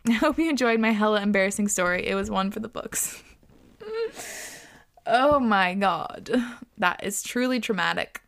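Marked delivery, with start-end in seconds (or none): 3.8-4.5 squeaky burst of laughter; a high little giggle. Real, not acted. Laughing at story.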